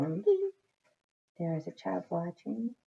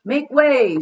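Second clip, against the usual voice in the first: higher and much louder shout; 6 semitones higher, 15 dB louder than usual. A narrator's reading. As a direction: bark it to clear the way. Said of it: "shouted the owner".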